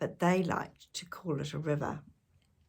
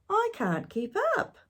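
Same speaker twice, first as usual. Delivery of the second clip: little brother whine; 14 semitones higher than usual. A narrator's reading. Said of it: "yelled Tom".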